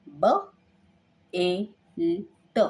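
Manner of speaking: each sound said apart, slowly: ब, ए, ल, ट; sounding out letters. Shown as a demonstration. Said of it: "L का sound आएगा ल".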